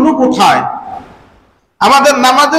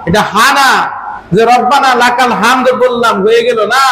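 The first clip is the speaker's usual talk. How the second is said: ordinary delivery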